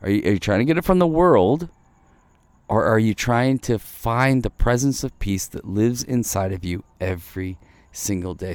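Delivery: ordinary talk, nothing marked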